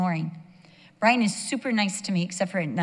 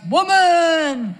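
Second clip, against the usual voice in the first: high, loud drawn-out yell imitating a husband; mimicked husband yelling; 9 semitones higher, 10 dB louder than usual. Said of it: "It's always".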